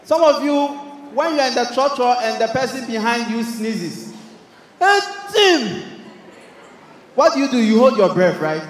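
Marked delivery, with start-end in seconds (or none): 4.8-5.8 mock sneeze. Trotro passenger sneezes, mimicked.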